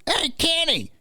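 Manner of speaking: put-on very high, screechy-old-lady holler; high mimicked call, last word drawn out; granny calling out. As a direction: imitate a granny hailing someone from a window. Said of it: "I hear this voice".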